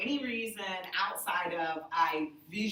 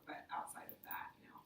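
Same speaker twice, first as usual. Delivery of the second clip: whispered; 16 dB quieter than usual. Real, not acted. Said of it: "unvoiced line".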